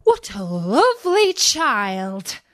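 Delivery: slow, hushed admiration; admiring dwarfs. A narrator's reading.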